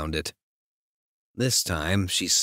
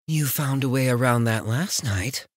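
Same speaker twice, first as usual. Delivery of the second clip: lightly voiced heroine; 7 semitones higher than usual. Heroine teases him.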